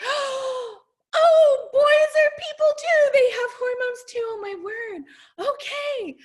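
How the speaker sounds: high-pitched gushing revelation; astonished realization. Retold or imitated. Mom realizing boys have hormones too, amazed. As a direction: burst out high as it dawns on you. Reenacts her startled realization about her son.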